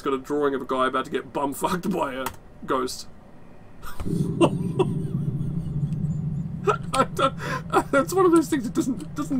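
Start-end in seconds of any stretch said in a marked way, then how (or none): 3.3-6.9 long, high, breathless laugh. Real, not acted. Reacts to the ghost drawing. 7.0-9.4 high voice through real laughter; laughing. Real, not acted. Still laughing at the scare.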